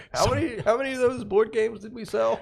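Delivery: put-on high, old-film transatlantic voice; posh old Hollywood executive; transatlantic accent. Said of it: "drop the transatlantic accent".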